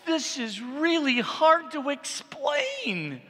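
dramatic preacher emphasis. Acted. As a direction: preach it, leaning hard on each word. Dramatic restatement continues.

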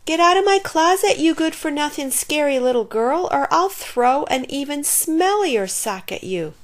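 high, loud, quick threat; little monster's indignant yell from his bed. A narrator's reading. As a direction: yell it to scare off an intruder. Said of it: "yelled Albert".

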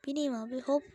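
ordinary talk, nothing marked

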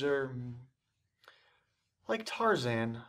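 ordinary delivery

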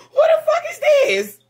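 high-pitched laughing disbelief; real surprise cracking into a laugh; laughing shock. Real, not acted. Shocked by the card reading.

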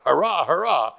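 a hurrah called out in demonstration; cheering hurrah. Shown as a demonstration. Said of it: "to our".

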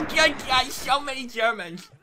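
high, loud cry of alarm. Real, not acted. Gamer overrun by Germans, alarmed high shout. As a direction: shout it high, stretching the end.

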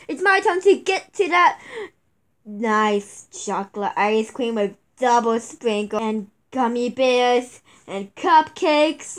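ordinary talk, nothing marked

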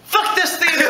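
high, outraged mock yell; mock furious yell. Customer throwing her soda at the usher, mock yell. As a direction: fling the words out as the drink flies.

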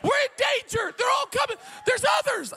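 high shouted alarm, repeated; hammed-up panic shout; mock alarmed lady. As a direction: cry out the danger like a frightened woman. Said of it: "Another lady says".

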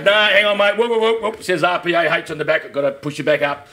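lightly voiced cop. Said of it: "So he goes".

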